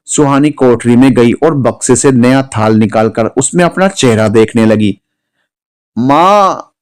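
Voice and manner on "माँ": sudden high cry of माँ; a mild acted shriek of माँ; scream. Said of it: "सुहानी की चीख".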